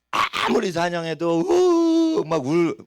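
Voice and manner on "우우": long, high, loud howl; imitated lion howl. Preacher showing how a lion cries out while hunting, imitated howl. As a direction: draw out a high lion's cry. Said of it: "울 수 있잖아, 사자도".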